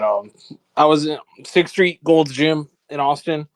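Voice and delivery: ordinary delivery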